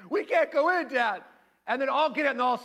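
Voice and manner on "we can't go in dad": high, loud mock panic; frantic kid-style outcry; imitated panicked kids. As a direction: plead with Dad in comic terror. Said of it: "Their demeanor in the hallway is this".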